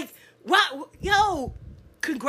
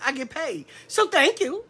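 ordinary talk, nothing marked

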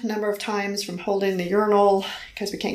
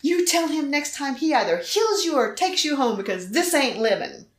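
mad; 5 semitones higher than usual. Retold or imitated. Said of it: "I was so mad".

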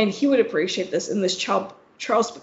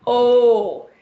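realizing; 8 dB louder than usual. Retold or imitated. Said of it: "I was just like".

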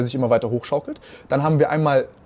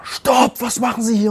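retold cop shout; 8 semitones higher, slower than usual. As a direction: shout it like the armed cop twenty metres off. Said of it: "schreit er schon".

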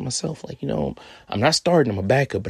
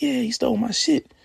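high, loud, bitter confirmation; Kyler's voice; 3 dB louder than usual. Retold or imitated. Kyler confirming to the narrator his stuff was stolen, high and loud. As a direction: pitch way up as the robbed friend. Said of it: "He like".

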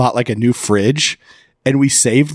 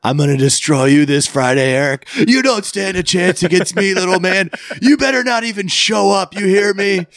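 wrestling promo; 8 semitones higher than usual. Retold or imitated. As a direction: hype up like a pro wrestler taunting. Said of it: "cut a promo basically".

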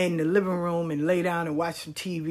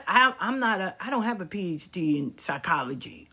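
usual speaking voice

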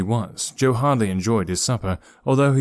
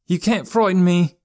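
put-on high, raised, defiant boy's voice; defiant cry; 9 semitones higher, 4 dB louder than usual. A narrator's reading. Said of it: "cried Joe defiantly".

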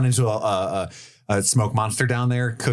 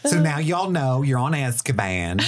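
slow, higher-pitched Southern drawl; Southern Dementor; 5 semitones higher than usual. Pretend. Dementor telling visitors where they are, slow Southern drawl. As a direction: drawl it out as a folksy ghoul. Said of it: "I love the Southern Dementor bit".

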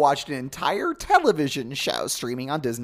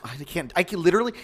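ordinary talk

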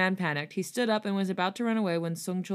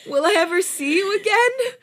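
high and loud; 14 semitones higher, 10 dB louder than usual. Acted. Prince asks longingly.

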